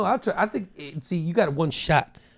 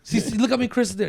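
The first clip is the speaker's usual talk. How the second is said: ordinary talk, nothing marked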